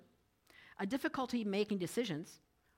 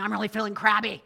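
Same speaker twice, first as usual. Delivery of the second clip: loud, grumpy complaint from Lucy; crabby Lucy; 10 dB louder than usual. Retold or imitated. Lucy loudly telling Linus she feels crabby. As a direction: play the cartoon girl griping loudly. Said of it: "Lucy was saying".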